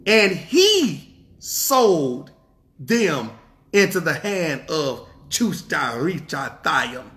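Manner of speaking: slow, deliberate scripture reading; slow emphatic reading of scripture; read slowly. Shown as a demonstration. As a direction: read it slow so every word lands. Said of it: "we gonna read it again slow".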